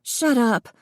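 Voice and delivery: high, short snap; snapped. A narrator's reading. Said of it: "the twins snapped in unison".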